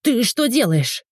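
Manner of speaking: high, slow, indignant question; indignant cry. A narrator's reading. Woman spots the camera turned on her, indignant cry. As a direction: cry out indignantly, without real volume. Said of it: "закричала возмущённо".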